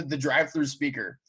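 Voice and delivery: usual speaking voice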